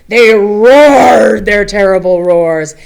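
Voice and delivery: loud, slow growling read of the roars; big monster roar for the kids. A narrator's reading. Roaring monsters read aloud.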